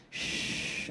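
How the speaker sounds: breathy voiceless shush; mock whispered shush. Nurse shushing the patient, whispered. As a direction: hush her with a whispered shh.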